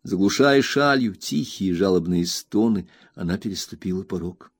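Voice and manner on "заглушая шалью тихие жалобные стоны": ordinary delivery, nothing marked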